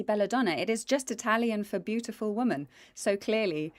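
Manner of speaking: ordinary delivery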